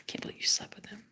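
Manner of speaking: imitating him in a whisper; very soft, breath-only retelling; whispered disbelief. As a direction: whisper it as his stunned confession. Ex's words retold.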